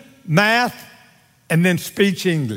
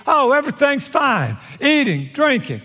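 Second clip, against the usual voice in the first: man below to himself, breezy and higher-pitched; carefree man; 8 semitones higher than usual. Acted. Man looking up from his point of view, carefree. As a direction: play a man who sees no trouble, pitched up. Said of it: "Man looking up from his point of view".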